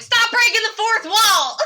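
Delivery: high, laughing yell; mock outraged shout. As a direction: shout it in playful protest. Mock outburst throwing popcorn at him.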